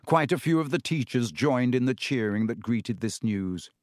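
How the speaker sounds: ordinary delivery, nothing marked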